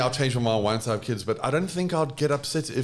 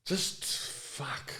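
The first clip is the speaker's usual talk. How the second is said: breathy exhale curse; 9 dB quieter, much slower than usual. Real, not acted. Overwhelmed by question.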